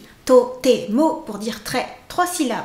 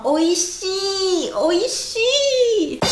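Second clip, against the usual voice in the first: oishi rising higher with the long final i; feeling-filled oishi; 5 semitones higher, much slower than usual. Shown as a demonstration. Teacher stressing the long final i, with feeling. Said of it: "si vous y mettez le sentiment".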